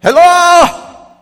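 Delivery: very high, loud put-on Spain accent; mock Spanish accent. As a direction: yell it in a badly faked Spanish accent. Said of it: "that's my best accent".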